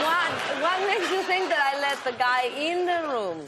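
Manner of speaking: high, quick, incredulous challenge; playful indignation. Real, not acted. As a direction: push back, pretending to be scandalized. Protesting host's teasing insinuation.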